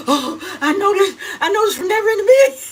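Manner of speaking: high, loud gushing squeal with laughter; excited squeal. Retold or imitated. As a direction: squeal like you just got a surprise gift. Excited reaction recalling gift.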